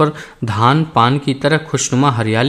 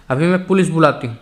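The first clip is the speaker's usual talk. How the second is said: ordinary delivery, nothing marked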